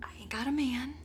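a small embarrassed whisper; whispered confession. Retold or imitated. Woman admitting to Jesus she has no man, whispered. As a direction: whisper it sheepishly. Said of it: "She's like".

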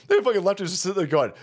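normal talking voice

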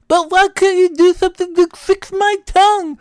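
very high, loud wail with tongue hanging out; tongue-out talk. Acted. Husband panicking to wife about his bleeding tongue, high tongue-out wail. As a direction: whine thickly around a stuck-out tongue. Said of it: "I just talked like that".

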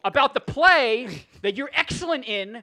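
loud, high, half-laughing hype; loud urging. Real, not acted. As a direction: hype it up loudly. Loudly urging co-host to plug.

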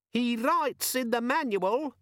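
high, slow, spiteful tattle; nasty squeaky demon. A narrator's reading. Demon exposing Vimes to the others, nasty squeak. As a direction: squeak it like a telltale. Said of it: "said the demon nastily".

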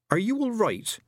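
high, urgent plea; begging him to answer; desperate. Acted. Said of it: "said Tiffany desperately".

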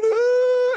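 short high sobbing "Ah"; a high, loud overwhelmed cry; retold sobbing cry. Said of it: "Why are you crying?".

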